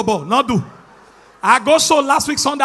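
ordinary talk

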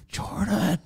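high, soft acted call; imitating a furtive bedtime call; hushed calling. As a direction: call her name as if trying not to be heard. Retells calling wife in bed.

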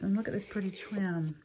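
ordinary talk, nothing marked

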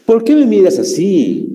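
high emphatic "¿Por qué me miras así?". Shown as a demonstration. Teacher demonstrating exclamation marks, "¿Por qué me miras así?" emphatic and high. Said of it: "Estoy enfatizando".

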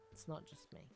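voiceless whispered repeat; whispered aside. Real, not acted. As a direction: whisper it to yourself as an afterthought. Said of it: "repeat of line 111".

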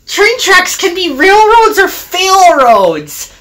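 high-pitched cheeky truck voice; teasing trucks. A narrator's reading. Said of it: "laughed the troublesome trucks".

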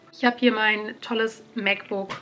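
normal talking voice

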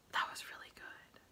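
whispered in awe; hushed, amazed whisper; awed whisper. Real, not acted. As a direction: breathe it out in a whisper. Awed reaction after routine.

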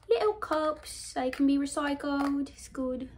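put-on British accent, pitched high. Vlogger showing craft kit cups, mock British accent. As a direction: slip into a playful British voice. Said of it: "started talking in the British accent".

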